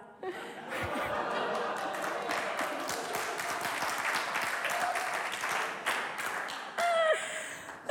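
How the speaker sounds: long high-pitched unvoiced cackle; wheezing high laugh at her own joke; high breathy laugh. Real, not acted. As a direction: burst out in a squealing laugh.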